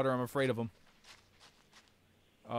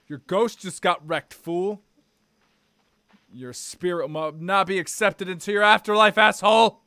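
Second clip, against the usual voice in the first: loud trash-talking gloat; gloating over a killed enemy; mock taunt; 2 semitones higher, 8 dB louder than usual. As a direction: yell insults at the beaten foe.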